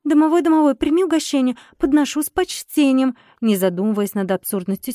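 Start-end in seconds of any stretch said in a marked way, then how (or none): 0.0-3.2 ritual incantation. A narrator's reading. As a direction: recite it like an old folk spell. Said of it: "произнесла я".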